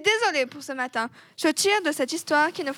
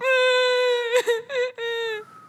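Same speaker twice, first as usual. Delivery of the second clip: loud, high theatrical bawling; hammed-up wail; mock crying wail; 9 semitones higher, 4 dB louder than usual. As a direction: burst into exaggerated, drawn-out sobs. Acted wail after talk of crying.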